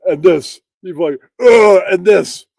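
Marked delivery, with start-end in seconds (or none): 1.4-1.8 loud mock grunt, chest puffed; loud flexing grunt. Podcaster mocking a buff streamer: loud grunt. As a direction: strain it out like a bodybuilder flexing.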